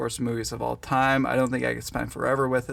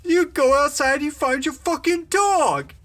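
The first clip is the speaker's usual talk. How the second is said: much higher, louder mock yell; yelled movie quote; 13 semitones higher, 3 dB louder than usual. Friend quoting Billy Madison in a high crazed yell. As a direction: squeal it out in a crazed character voice. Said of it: "referenced it".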